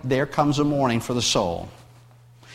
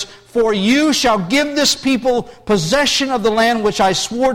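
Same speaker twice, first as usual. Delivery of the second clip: loud, raised proclamation of scripture; scripture reading; 5 semitones higher, 4 dB louder than usual. Acted. Pastor reading God's promise to Joshua, proclaiming. Reads Joshua one aloud.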